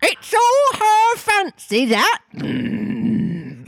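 very high, slow squawking voice; Griffin voice. Acted. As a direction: drag it out like a parrot. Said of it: "He's on a register".

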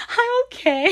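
a high, wobbly attempt to sound fine; tearful reassurance. Crying in the back room, she tells herself she's okay tearfully. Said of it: "went in the back and cried".

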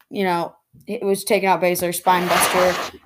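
usual speaking voice

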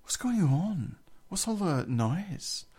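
high, drawn-out mock questioning; imitated bewildered housemate. Housemate coming downstairs into a row, high bewildered imitation. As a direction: play him baffled by the racket.

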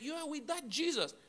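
put-on high, childish denial; preacher mimicking Peter as a petulant kid, pitched high. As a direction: play a defensive nine-year-old brushing it off. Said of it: "a little nine-year-old kid who just said".

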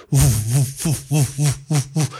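repeated puffs of air; imitated fan whooshes. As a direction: mimic a giant fan beating. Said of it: "mit einem riesigen Fächer".

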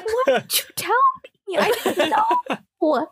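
high-pitched comic protest, half laughing; mock indignation. High teller protesting that friends never told them, mock indignation. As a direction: whine it at them in mock outrage.

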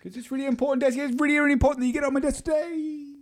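very high, fast nagging manager; whiny boss chasing a report; squeaky boss voice. Acted. Boss character on phone.

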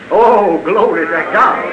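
usual speaking voice

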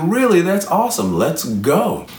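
high-pitched, delighted reaction; storybook enthusiasm, easing down toward the end; excited panda. Acted. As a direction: leap up in pitch with eager surprise. Panda delighted fish will climb.

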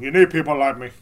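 half-convincing movie gangster; Scarface. Acted. Walking out of the Facebook room, defiant Scarface. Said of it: "can't do a good Scarface impression".

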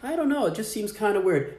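high, sweet doubtful tone; mock innocent. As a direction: act puzzled, innocently. Acting innocent to scammer.

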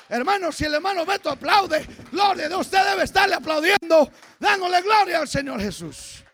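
high, loud shouted exhortation; full-force preaching. Real, not acted. Preacher urging a brother to applaud God, full-force preaching. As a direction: spur the listeners to praise at a shout.

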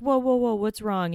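high, loud startled whoa; alarmed whoa. Retold or imitated. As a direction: burst in alarmed, asking what's wrong. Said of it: "then she's like".

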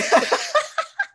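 loud high laugh. Real, not acted. Friend cracking up at the story, loud high laugh.

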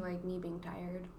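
tired whispered trail-off; whispering. Real, not acted. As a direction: let it fade to a whisper.

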